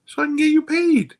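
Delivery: high, loud imitated caller; big high-pitched impersonation of the caller; lovely lady caller. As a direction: sing out the lady's line, way up high.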